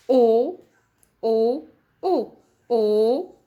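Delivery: loud, drawn-out O sounds; teacher calling out the letter O; sounding out O. Shown as a demonstration. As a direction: call each O out loud while writing. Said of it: "écris sur ton ardoise".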